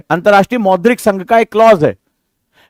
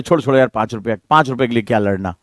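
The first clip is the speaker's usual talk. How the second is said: low, soft giving-in voice; a father quietly settling a petty fight; placating mutter; 9 semitones lower, 6 dB quieter than usual. Retold or imitated. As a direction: brush it off softly, just let it go. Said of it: "ऐसे बोलते कि नहीं?".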